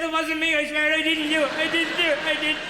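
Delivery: high, loud frantic pleading; a scared child swearing innocence; shrill panicked protest of innocence; panicked denial. Acted.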